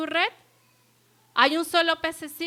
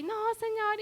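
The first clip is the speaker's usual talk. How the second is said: high wailing cry of no; crying plea; 9 semitones higher than usual. Retold or imitated. Begging God not to take her friend, crying high. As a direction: cry it out, pitched way up. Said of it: "Y lloraba".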